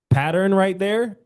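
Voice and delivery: drawn out and lifted; emphatic. Shown as a demonstration. Coach points to the rhythm of the demo. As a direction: stress pattern right there. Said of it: "That rhythmic".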